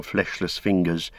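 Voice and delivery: plain reading voice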